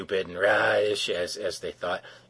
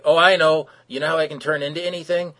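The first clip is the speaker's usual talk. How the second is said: eager; 8 semitones higher, 9 dB louder than usual. Retold or imitated. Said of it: "Metamorpho's like".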